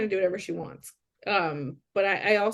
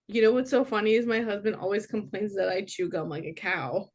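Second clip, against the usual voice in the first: ordinary delivery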